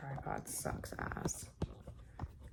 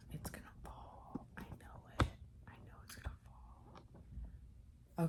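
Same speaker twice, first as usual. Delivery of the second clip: barely voiced whisper to yourself; hushed, slow self-talk; resigned whisper; 10 dB quieter than usual. Real, not acted. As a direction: whisper it very softly, drawing out the last words. Tripod about to fall.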